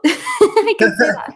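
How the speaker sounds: high, slow giggly words through a laugh. Real, not acted. Asked to speak Afrikaans, laughing through it. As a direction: laugh it out, half embarrassed. Laughing at her own shyness.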